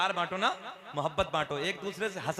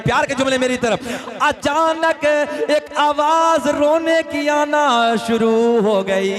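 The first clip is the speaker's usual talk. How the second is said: preaching at full force; 2 semitones higher, 11 dB louder, slower than usual. Acted. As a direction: sing out the story to the crowd. Chanted sermon story of crying.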